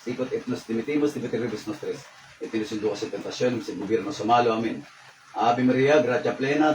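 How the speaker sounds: rapid, run-together Latin chanting in an even tone; ritual prayer recitation. Real, not acted. Healer praying over a patient, ritual recitation. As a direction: recite a memorised incantation, sinking at the end.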